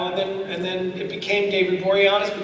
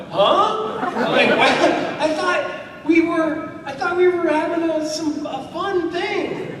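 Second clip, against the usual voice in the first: high, mock-panicked protest; alarmed bewilderment; 10 semitones higher, 3 dB louder, slower than usual. Tourist grabbed by a policeman, high hammed-up alarm. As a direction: squeak out a baffled plea, hammed-up. Retold alarm at being arrested.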